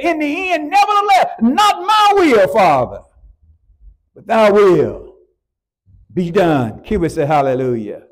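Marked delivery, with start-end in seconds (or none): 0.0-6.8 surrender your will to the Father with a cry; Jesus pleading in prayer. Acted. Quoting Jesus praying in Gethsemane.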